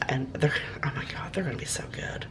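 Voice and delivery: breathy secret whisper, words barely voiced; excited whisper. Real, not acted. Baker whispering about her brownies.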